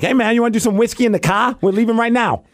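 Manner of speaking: light Boston accent impression. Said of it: "that's my only Boston impression".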